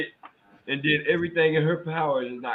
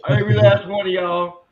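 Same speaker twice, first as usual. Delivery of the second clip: excited; 9 semitones higher, 9 dB louder than usual. Real, not acted. Calling out laughing cohosts.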